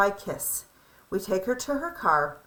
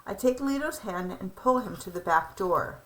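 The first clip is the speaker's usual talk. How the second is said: ordinary delivery, nothing marked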